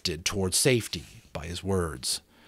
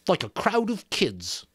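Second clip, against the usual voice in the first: disgusted; 9 semitones higher than usual. Acted. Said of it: "watched him in disgust".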